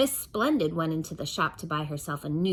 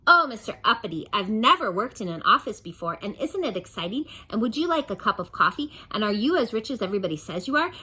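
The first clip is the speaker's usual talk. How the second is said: louder chatter, rushing at the end; gushing secretary; 4 semitones higher, 5 dB louder, faster than usual. Acted. Secretary chattering to Mister Uppity, eager gush. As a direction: babble on excitedly.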